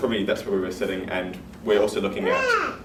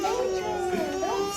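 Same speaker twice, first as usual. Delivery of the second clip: high-pitched burst of laughter; can't hold back a giggle. Real, not acted. Host losing it mid-presentation, giggling.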